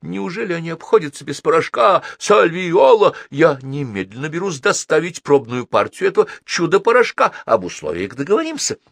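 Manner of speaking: high, loud astonished salesman; impassioned high-voiced diplomat; excitable consul, aghast then eager. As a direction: gush with urgency, pitch up.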